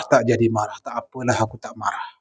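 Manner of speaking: dropping to a soft murmur; fading to a murmur. Retold or imitated. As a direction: let it die away to near silence. Inner voice calming itself down.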